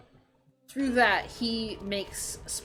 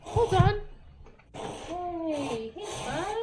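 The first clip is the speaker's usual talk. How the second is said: much higher, cooing to a pet; pet talk; 9 semitones higher, slower than usual. Real, not acted. Streamer stopping to fuss over a pet, cooing. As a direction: coo at the little buddy.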